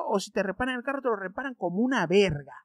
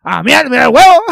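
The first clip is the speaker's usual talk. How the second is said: loud mock outburst as the uncle; imitating my uncle; 3 semitones higher, 16 dB louder than usual. Teasing cousin by imitating the uncle, loud mock outburst. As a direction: blurt it out loudly like the shocked uncle. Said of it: "yo hablaba como mi tío y decía".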